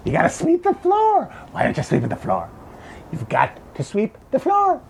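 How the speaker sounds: high, strained mock Trump voice; mocking Trump's repeated floor-sweeping line in a squeaky put-on voice; Trump impression. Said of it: "he's doing his usual thing about forest fires where he says".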